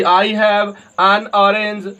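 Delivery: "I have an orange", each word drawn out, "an" said as un; very slow demo. Shown as a demonstration. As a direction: drag the sentence out for the class. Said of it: "हम लोग ... पढ़ेंगे". ("an" को अन पढ़ते हुए बहुत धीमा उदाहरण)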